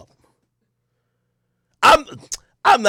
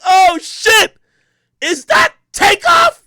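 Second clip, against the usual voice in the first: high, loud starstruck yell; excited fan; 6 dB louder, much slower than usual. Retold or imitated. Thrilled host spotting [name] at the event, excited yelling. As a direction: shout it high, stretching the name. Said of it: "So I'm excited".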